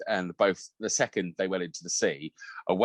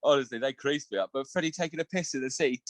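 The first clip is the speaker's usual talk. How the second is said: usual speaking voice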